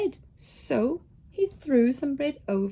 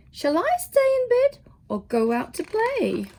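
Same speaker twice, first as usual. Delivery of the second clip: high questioning voice; 10 semitones higher than usual. Acted. Character's yawning question, high.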